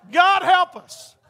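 slow, loud and high groan of "God help us"; exasperated comic sigh; mock despair. Real, not acted. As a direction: throw up your hands at the joke. Exasperated aside after joke.

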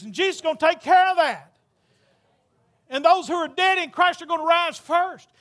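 fervent revival preaching. Acted. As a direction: build the crowd toward heaven. Builds sermon to full force.

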